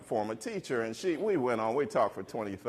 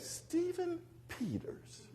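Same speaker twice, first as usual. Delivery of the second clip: calm recognition; 13 dB quieter, much slower than usual. Retold or imitated. Teacher recognizing former student.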